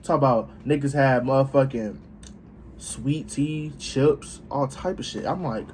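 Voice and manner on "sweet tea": normal talking voice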